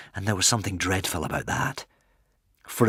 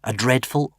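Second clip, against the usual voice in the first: usual speaking voice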